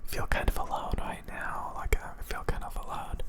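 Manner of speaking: barely audible sad whisper; lonely whisper. Real, not acted. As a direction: whisper it to yourself, feeling alone. Sudden lonely confession.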